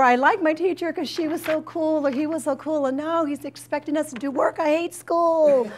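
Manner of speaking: high whiny student voice, dragging out the end; mock student whining. Counselor mimicking a student sulking about a strict teacher, high whine. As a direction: sulk it like a student who hates work.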